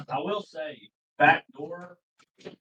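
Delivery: distant, off-mic and soft; away from mic. Real, not acted. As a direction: speak from across the room. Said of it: "wait till you're back next to the computer".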